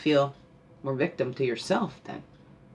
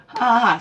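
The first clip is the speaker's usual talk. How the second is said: loud, higher ha ha ha; hearty demo laugh; 6 semitones higher, 9 dB louder than usual. Shown as a demonstration. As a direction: laugh out loud to show them. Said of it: "So let's laugh".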